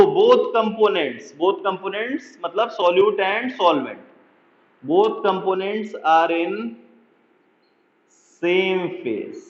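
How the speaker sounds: very slow, measured dictation. Shown as a demonstration. Teacher dictating a definition to students taking notes, very slowly. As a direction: drag each word so students can copy it.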